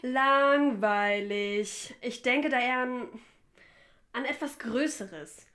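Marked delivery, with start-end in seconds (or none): 0.0-2.0 drawn out, very slowly, louder; bored dismissal. Acted. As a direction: drag the word out to dismiss the idea. Dismisses the suggestion. (gelangweilt und sehr gedehnt)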